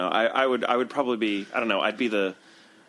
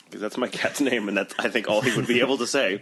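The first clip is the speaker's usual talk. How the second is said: normal talking voice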